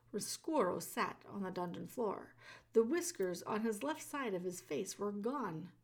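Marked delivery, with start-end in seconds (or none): none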